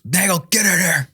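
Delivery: mock a livid sergeant major calling someone in; high-pitched mock bellow of an order; imitated yell. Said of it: "I'm getting yelled at".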